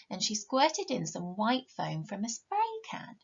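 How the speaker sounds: plain reading voice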